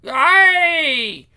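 sharp high yelp of pain; pained cry. Acted. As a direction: cry out as if struck. Boy struck by father.

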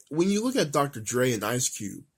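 ordinary talk, nothing marked

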